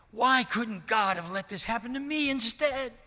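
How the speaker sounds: high, loud, anguished imitation of a weeping mother; retold sobbing plea. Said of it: "she sobbed some more".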